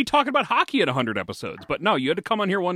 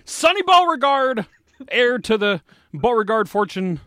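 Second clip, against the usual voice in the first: announcer voice; 3 semitones higher than usual. Pretend. Host introduces arriving guests.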